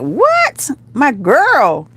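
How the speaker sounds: high squealed reaction, dropping on the end; playful high-pitched astonishment; acted delighted surprise. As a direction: re-enact being thrilled for a friend. Re-enacts delighted surprise at friend.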